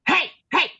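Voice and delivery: high cartoon villain voice, excited; Penguin voice. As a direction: imitate the thrilled coach in his Penguin voice. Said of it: "he spoke like the Penguin".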